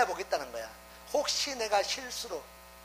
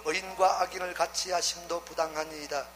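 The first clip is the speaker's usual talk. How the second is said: measured, lower Bible reading voice; scripture reading; 5 semitones lower than usual. Acted. Preacher reading Genesis to the congregation, in a measured reading voice. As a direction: read the verses steadily, a little lower. Reads scripture passage aloud.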